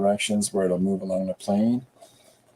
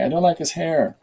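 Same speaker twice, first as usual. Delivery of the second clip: higher-pitched voiced-for-pet complaint; speaking for the pet; 6 semitones higher, 3 dB louder than usual. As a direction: put words in a pet's mouth, pitched up.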